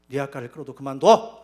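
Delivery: a sudden loud outburst, mimicking his wife's flat refusal; wife's shouted order. Wife shouting at husband to quit no matter what, loud outburst. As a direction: bark the order loud to end the argument.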